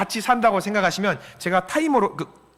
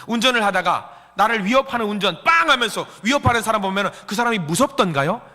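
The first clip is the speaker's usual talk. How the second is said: ordinary talk, nothing marked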